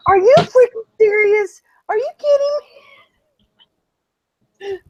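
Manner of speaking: shrill, very high and loud mock outrage; furious wife. Wife outraged the kids are outside, very high and loud. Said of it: "She goes".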